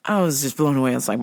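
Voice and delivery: ordinary talk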